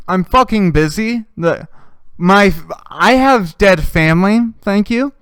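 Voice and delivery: mock indignant rebuff. Imagined rebuff to someone talking.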